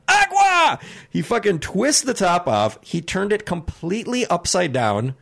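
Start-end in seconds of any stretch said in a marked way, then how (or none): 0.1-0.8 high, loud shouted "Agua!"; yelled demand. Retold or imitated. Sweaty customer shouting for water at counter, loud yell. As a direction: bellow "Agua!" like a desperate thirsty man. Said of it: "he yells".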